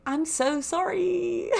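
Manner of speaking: high, drawn-out sheepish apology; mock-mortified apology. Apologising to the cab driver, high drawn-out mock apology. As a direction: stretch it into a high little wail.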